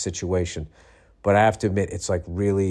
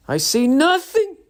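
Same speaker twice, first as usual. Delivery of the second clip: loud, high, drawn-out sitcom catchphrase; comic put-on sergeant voice; Sergeant Schultz; 5 dB louder than usual. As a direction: proclaim innocence in a slow, hammed-up cry. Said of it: "I feel like I'm Sergeant Schultz".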